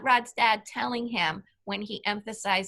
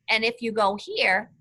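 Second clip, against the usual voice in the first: ordinary talk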